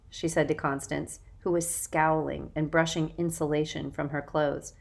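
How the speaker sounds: ordinary delivery, nothing marked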